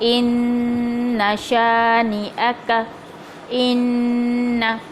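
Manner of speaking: इन्ना with a short held nasal ghunna on the doubled नून, slow; Quran recitation. Shown as a demonstration. Teacher demonstrating इन्ना with ghunna, slowly. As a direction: hold the nun of इन्ना two counts, no lengthening. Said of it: "जैसे मैंने बताया है कि इन्ना".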